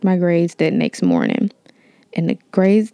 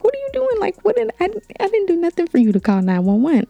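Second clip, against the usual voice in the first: high, panicked disbelief; imitating her own frazzled high-pitched protest; 12 semitones higher, faster than usual. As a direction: squeak it out, rattled and confused. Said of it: "get frazzled because I'm like".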